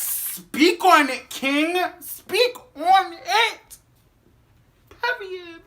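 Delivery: high, loud, slow drawn-out cheer; hype cheer. Real, not acted. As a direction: cheer the singer on like he's preaching. Hyping the singer, calling out.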